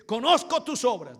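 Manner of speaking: high, booming preacher's shout; forceful rebuke. Acted. Preacher as the Lord coming down hard on the church, shouting. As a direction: hurl it at them as a rebuke. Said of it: "ahí comienza el Señor a darle fuerte".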